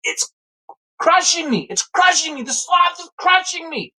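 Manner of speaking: put-on very high panicked kid's wail; tearful mock screaming. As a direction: squeal it out as if being crushed. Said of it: "tears, tears, okay? I'm crying".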